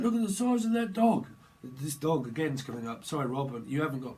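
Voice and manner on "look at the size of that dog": mock alarmed. Scared robber.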